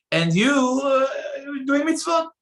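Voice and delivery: high, loud, cry of outrage; indignant outburst. Acted. Preacher rebuking the man who calls sin a mitzvah, outraged shout. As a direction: shout it out in disbelief at the hypocrisy.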